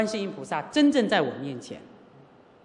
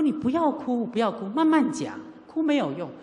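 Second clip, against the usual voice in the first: higher-pitched retold plea to stop crying; urgent hushing; 7 semitones higher than usual. Daughter to crying mother on phone, urgent hushing. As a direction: talk a sobbing caller down, insistently.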